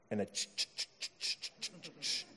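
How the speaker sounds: breathy, voiceless imitation of chugging electric guitar strings; electric guitar noise. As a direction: fade out the ch- sounds like a guitar.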